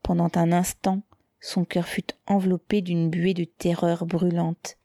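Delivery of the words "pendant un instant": usual speaking voice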